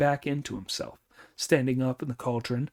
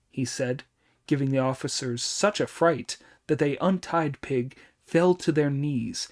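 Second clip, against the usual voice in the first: ordinary delivery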